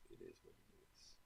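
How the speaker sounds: a low whisper, barely breathed; whispered repeat. Shown as a demonstration. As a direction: whisper it, resigned and drained.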